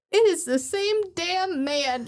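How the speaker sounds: a light take on his own stunned reaction; imitating the voice. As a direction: spell out the obvious, slowly. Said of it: "in that voice and everything".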